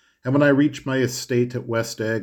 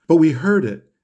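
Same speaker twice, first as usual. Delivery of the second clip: "heard" pressed hard, higher and louder; insisting; 6 semitones higher, 7 dB louder than usual. A narrator's reading. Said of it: "insisted Daisy".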